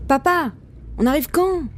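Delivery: put-on high-pitched boy's pestering question; impatient child. A narrator's reading. Boy asking papa about arrival, impatient child voice. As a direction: pester dad like a restless kid.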